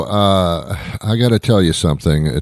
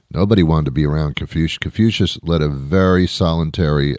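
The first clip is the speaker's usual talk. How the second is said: usual speaking voice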